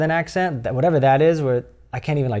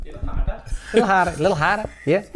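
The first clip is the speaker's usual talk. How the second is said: higher mock New Zealand bedroom coaching; Kiwi accent; 3 semitones higher, 4 dB quieter than usual. Comic playing a New Zealander during sex, mock Kiwi accent. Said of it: "the Kiwi accent too".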